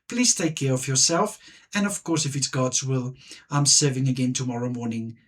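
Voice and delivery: ordinary talk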